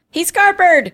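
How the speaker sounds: high, loud cry from a brawl; quoted shout. Retold or imitated. Retelling a TV fight to co-host, quoted shout. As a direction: call it out over a fight.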